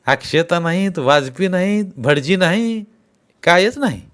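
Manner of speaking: gossiping villager. Acted. Villagers chatting about the sarpanch and the wedding, animated gossip.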